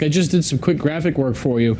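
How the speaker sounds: usual speaking voice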